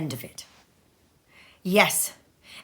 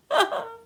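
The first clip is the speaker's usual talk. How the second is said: joyful laugh; 13 semitones higher than usual. A narrator's reading. Scrooge overjoyed.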